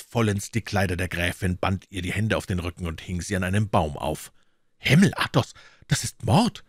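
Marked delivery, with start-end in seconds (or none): 4.8-6.6 high, louder horrified exclamation; appalled outburst; shocked cry. A narrator's reading. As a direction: cry out in shock, pitch leaping up. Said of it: "rief D'Artagnan".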